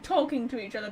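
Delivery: normal talking voice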